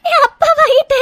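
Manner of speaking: overwhelmed, weepy relief; tearful joy. Acted. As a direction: voice full of happy tears. Weeping with joy to husband.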